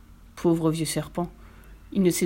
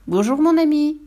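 higher, louder cheery hello; bright greeting; 8 semitones higher, 6 dB louder than usual. A narrator's reading. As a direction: call out a cheeky hello. Mouse greets the snake.

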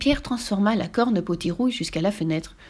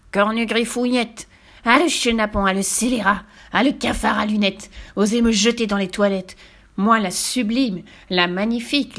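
raging witch ranting. Acted. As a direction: rant like an offended witch.

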